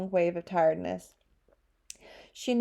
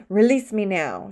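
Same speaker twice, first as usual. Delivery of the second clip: growling; 7 dB louder than usual. A narrator's reading. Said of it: "she growled at him".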